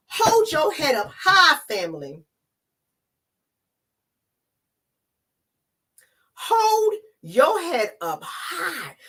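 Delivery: high, slow, stretched with emotion; proud and moved. Real, not acted. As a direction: lift them up, slowly. Proud self-encouragement, emotional.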